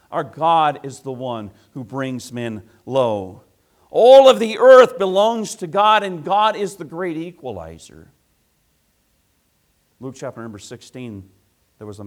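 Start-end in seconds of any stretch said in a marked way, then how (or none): 3.9-8.1 loud high burst on All; preaching at full force. Acted. Preacher cry.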